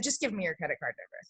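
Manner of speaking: quick, low, offhand ask; nonchalant. Retold or imitated. Asking a friend for her card number, quick and offhand. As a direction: toss it off fast like it's nothing. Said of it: "I made it so nonchalant".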